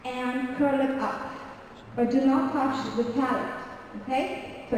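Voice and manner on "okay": normal talking voice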